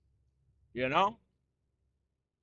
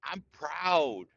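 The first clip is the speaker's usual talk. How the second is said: usual speaking voice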